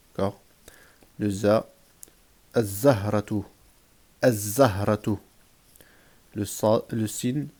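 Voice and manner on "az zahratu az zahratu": "az-zahratu" with a held double z, no L; doubled first letter. Shown as a demonstration. Teacher drilling sun-letter words, doubled z. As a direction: hold the z in "az-zahratu". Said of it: "on va doubler".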